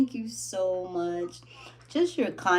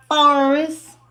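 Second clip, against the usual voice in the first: normal talking voice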